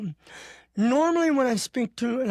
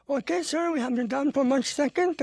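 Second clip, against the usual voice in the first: higher, brisk courteous reply; polite clerk; 5 semitones higher than usual. Retold or imitated. Hospital clerk finally understanding him, higher polite voice. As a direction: answer like a service clerk, pitch up. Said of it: "I speak to him and I said".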